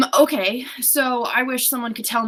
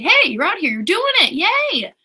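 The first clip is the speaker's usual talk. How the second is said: high, loud celebratory cheer; cheering universe; 8 semitones higher, 7 dB louder than usual. Retold or imitated. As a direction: cheer them on, pitched way up. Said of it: "The universe was trying to say".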